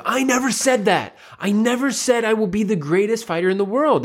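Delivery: put-on high, whiny kid protest; indignant high-pitched denial; sensitive denial. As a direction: imitate an upset boy insisting he's been misquoted. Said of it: "the older brother got very sensitive".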